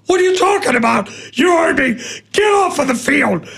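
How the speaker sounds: fast, high, angry yelling; angry shout. Retold or imitated. Kid batter shouting back at the pitcher, high angry yell. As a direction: shout him down in outrage.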